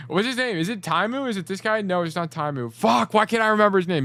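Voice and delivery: high, agitated questions building to a loud outburst; real exasperation. Real, not acted. Coach blanking on a player's name, exasperated and rising to a loud outburst. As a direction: rack your brain aloud, then burst out swearing.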